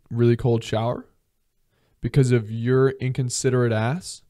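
usual speaking voice

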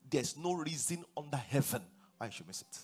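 normal talking voice

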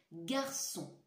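usual speaking voice

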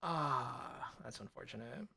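real deflated resignation; resigned mutter. Real, not acted. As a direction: let it go under your breath. Resigned after the loss.

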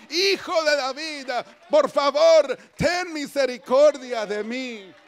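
loud, high, drawn-out cry. Acted. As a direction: plead at full voice for mercy. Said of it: "tú grita aún más". (grito de súplica a toda voz)

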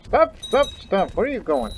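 high, loud repeated stop; urgent call. Pretend. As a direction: cry out to halt someone running off. Calling after units in the game.

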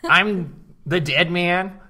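put-on high-pitched, slow Boston accent; a squeaky, overdone Boston drawl. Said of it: "a Boston accent".

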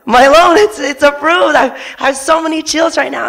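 high, loud, overjoyed retelling of the news; excited disbelief. Retold or imitated. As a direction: burst out like you can't believe your luck. Retelling man's loan approval joy.

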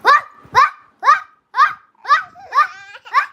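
put-on loud, high yappy barks in a child's voice; dog sound imitation. Boy does the dog's sound for the live viewers, loud mock barking. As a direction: bark each word out like a little dog. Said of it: "പട്ടിയുടെ sound ഒന്നുകൂടി ഇട്".

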